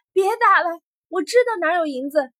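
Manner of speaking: loud, high-pitched desperate cry; shouting. A narrator's reading. Prisoner giving in under the beating, shouting. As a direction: shout to stop the blows. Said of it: "沈万三喊了一句".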